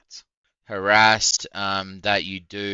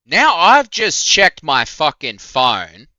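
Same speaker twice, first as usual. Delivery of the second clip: angry outburst; 8 semitones higher, 12 dB louder, slower than usual. Real, not acted. Angry rant about harasser.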